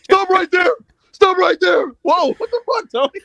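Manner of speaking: high, loud mock commands; mock shouting. Wrestlers storming in on Tony, in high mock shouts. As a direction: yell it like a cop bursting in.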